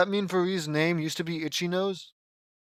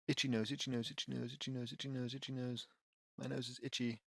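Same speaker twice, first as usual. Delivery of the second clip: muttered practice; 5 semitones lower, 18 dB quieter than usual. Shown as a demonstration. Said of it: "repeating the name".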